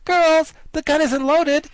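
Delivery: very high, loud and slow call to the troops; cried out. Acted. Ginger calls to her army, high loud shout. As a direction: call out the good news.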